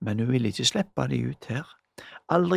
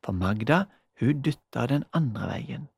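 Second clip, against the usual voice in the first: usual speaking voice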